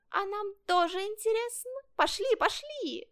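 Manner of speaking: high, excited little fox; fox cub calling out. A narrator's reading. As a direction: urge the others on in a high voice. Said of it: "закричал лисёнок". (высокий азартный крик лисёнка)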